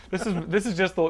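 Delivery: ordinary talk, nothing marked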